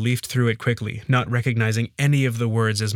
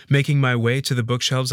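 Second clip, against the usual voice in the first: ordinary delivery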